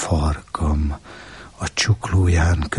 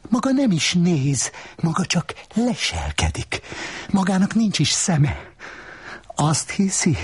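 much higher, taunting woman's voice; high-pitched scornful woman taunting the man; 12 semitones higher than usual. Acted. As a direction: needle him in a high, mocking voice. Woman character scolding the peeper.

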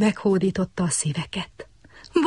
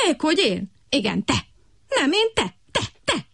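bickering frogs; 6 semitones higher than usual. A narrator's reading. Two frogs quarrel.

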